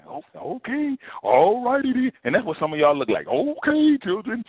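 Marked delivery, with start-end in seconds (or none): 0.1-2.1 lightly done goofy cartoon dad, higher and meek; a hint of a dopey cartoon dog giving in. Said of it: "who talk like that? Goofy?". 3.3-4.4 lightly done goofy cartoon voice, higher; a hint of a dopey cartoon dog.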